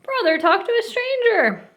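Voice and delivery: very high sing-song tattling call; tattling shout. A narrator's reading. Sister running home telling on Brother, high tattle. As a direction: announce it like a tattling little sister. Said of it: "ran home shouting".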